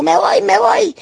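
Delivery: mimicked high-pitched outburst; angry storming-off retold. Sister storming out after the argument, imitated in a high angry voice. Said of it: "ella se enojó más".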